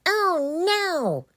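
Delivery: high screeching witch. Acted. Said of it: "screeched the witch".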